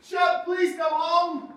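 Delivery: loud, high, drawn-out shout; desperate calling. Acted. Owner begging lost dog to go home, desperate calling. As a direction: bellow for the dog, stretching the words.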